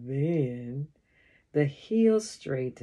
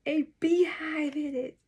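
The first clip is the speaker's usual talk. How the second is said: alarmed; 11 semitones higher than usual. A narrator's reading. Alarming discovery in the bush.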